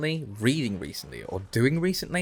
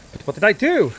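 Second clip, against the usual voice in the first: high, loud innocent whine; whiny protest; 10 semitones higher, 8 dB louder than usual. Pretend. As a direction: protest like the accused.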